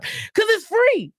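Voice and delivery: high, drawn-out squeal on free; emphatic plug. Real, not acted. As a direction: sing out the selling point high and slow. Hyped plug for her podcast.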